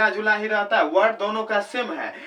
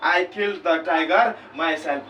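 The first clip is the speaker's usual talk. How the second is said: slow dictation read; 2 semitones lower, much slower than usual. Shown as a demonstration. Teacher reads example sentence.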